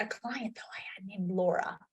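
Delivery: quick whispered aside. Real, not acted. Coach whispering a quick aside to the room. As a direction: lean in and whisper it fast. Aside before client story.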